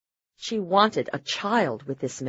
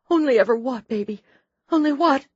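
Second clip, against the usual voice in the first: desperate urging; 9 semitones higher, 4 dB louder than usual. A narrator's reading. Wife urges dying husband.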